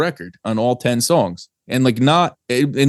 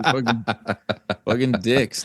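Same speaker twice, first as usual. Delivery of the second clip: laughing. Real, not acted. Joking insult, laughing.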